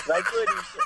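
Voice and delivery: laughing cheer. Real, not acted. Caller laughing, squeaky cheer.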